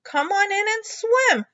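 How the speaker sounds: high, loud mother voice; mother calling Froggy into the pond; mother's voice. Acted. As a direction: call him in cheerfully.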